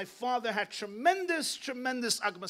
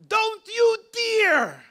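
much higher, louder, slow, each word hammered; a fierce shouted warning to the family; furious warning; 12 dB louder, much slower than usual. Retold or imitated. Grandfather defending his grandson before the family, furious slow shout.

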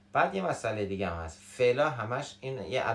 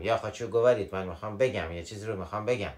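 ordinary talk, nothing marked

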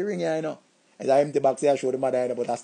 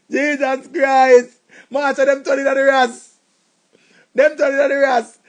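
outraged shout; 3 semitones higher, 6 dB louder than usual. Acted. Outraged exclamation.

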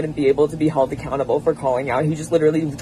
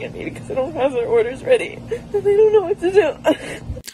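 laughing while crying; 13 semitones higher than usual. Real, not acted. Yelled at by customers at work.

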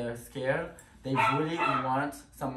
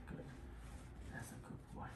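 soft whisper to the dog; whispered praise; 18 dB quieter than usual. Real, not acted. Trainer whispering praise to the nervous dog. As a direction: hush it out as praise.

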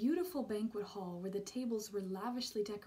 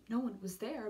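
ordinary delivery

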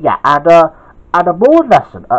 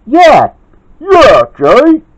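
loud, high agreement; raised, punchy character shout; 10 semitones higher, 7 dB louder, much slower than usual. A narrator's reading. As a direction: shout it in support. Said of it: "shouted Kid".

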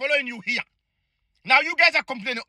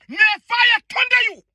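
higher and louder, hurled out in rage; shouted curse, pitched up; furious curse; 7 semitones higher, 4 dB louder than usual. Real, not acted. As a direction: spit the curse at them even harder. Angry curse repeated, escalating.

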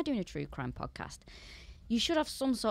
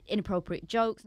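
ordinary talk

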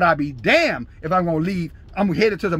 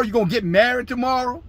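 ordinary talk, nothing marked

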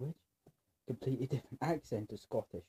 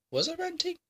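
very high, loud questioning squeak; squeaky self-doubt; 8 dB louder than usual. Real, not acted. Vlogger asking viewers about own rant, squeaky disbelief. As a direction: shoot right up high in disbelief.

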